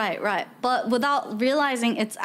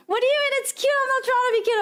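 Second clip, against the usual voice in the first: imitated offence in a high voice; mock indignant squeal; offended; 13 semitones higher, much faster than usual. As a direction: protest it, pitched right up. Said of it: "they get so offended".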